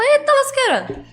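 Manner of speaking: high-pitched comic astonishment; mock shock, voice leaping up high; stunned reaction. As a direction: exclaim it like a viewer caught off guard. Acts out stunned viewer's reaction.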